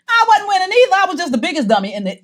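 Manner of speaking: high, loud exclamation at her own folly; loud self-mockery. Real, not acted. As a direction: burst out, ridiculing yourself. Loudly mocking her former self.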